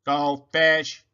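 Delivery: loud robotic blurt. A narrator's reading. Robot waking up too loud, robotic blurt. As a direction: blast it like a robot.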